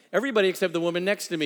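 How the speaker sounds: usual speaking voice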